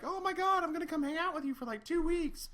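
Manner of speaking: put-on high, fast excited girly voice; girlfriend's voice. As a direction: gush it out like a thrilled girlfriend. Mimicking girlfriend's excited arrival.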